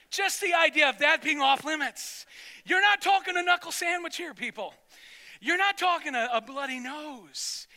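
loud, high-pitched pulpit proclamation; emphatic preaching. Real, not acted. Preacher warns congregation about the off-limits tabernacle, emphatic preaching. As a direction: raise your voice to drive it home.

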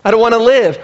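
loud, high, angry outburst; Jonah's anger. Acted. Jonah so offended at God he'd rather die, loud and angry. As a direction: throw it at God in a loud huff. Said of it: "No, that's anger".

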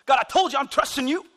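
high, loud mock teenage backtalk; smack-talking young teen. Kid talks smack to God, high loud teenage attitude. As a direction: talk back like an indignant thirteen-year-old. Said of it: "We suddenly turn into thirteen and fourteen-year-old kids".

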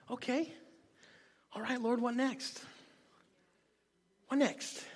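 soft, tentative asking; quiet private prayer. Acted. Preacher acting out asking the Lord what next, quietly. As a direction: ask under your voice, pausing between questions.

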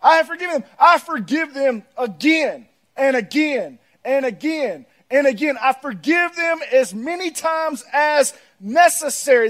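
loud, high preaching, big and insistent; preaching at full force. Acted. Preacher urging the church to forgive, loud and insistent. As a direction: preach it out to the congregation.

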